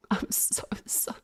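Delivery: hushed, guilty whisper of "sorry"; whispered apology. Real, not acted. Streamer apologizing to chat, whispered and guilty. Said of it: "apology repeated in whisper".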